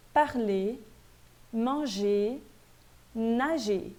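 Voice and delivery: parler, manger, nager each ending in "é", no R; silent final R. Shown as a demonstration. French teacher reading infinitives for students, final R silent. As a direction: read parler, manger, nager singly, dropping the last R. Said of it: "mas o R não é pronunciado".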